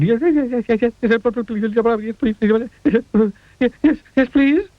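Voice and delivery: high, trembling eager squeaks; quivering. A narrator's reading. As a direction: quiver and beg. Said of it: "quivered and shook and said".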